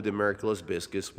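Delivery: usual speaking voice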